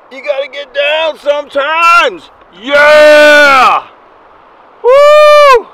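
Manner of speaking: high, loud triumphant yelling; excited shout. Real, not acted. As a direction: whoop as the fish comes in. Landing the big fish.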